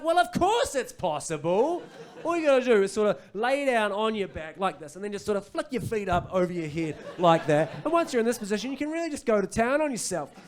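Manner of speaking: high, loud, hammed-up exasperation; annoyed. Annoyed host standing up to show the meeting, exasperated. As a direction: explain it loudly, at a high exasperated pitch. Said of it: "now I was annoyed".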